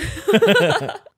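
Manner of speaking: high, loud burst of laughter; laughing. Real, not acted. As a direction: crack up after your own impression. Laughs after acting out herself.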